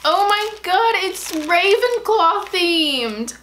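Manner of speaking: high, slow thrilled exclaim. Real, not acted. Thrilled to viewers about the Ravenclaw theme. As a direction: gush with joy. Sees her house theme.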